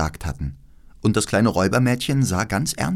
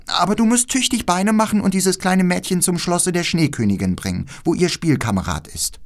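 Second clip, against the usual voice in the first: hohe, lebhafte Mädchenstimme; freches Räubermädchen; 7 semitones higher than usual. Acted. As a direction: play a bossy little robber girl, pitched up. Voices the robber girl. (keckes, forderndes Räubermädchen)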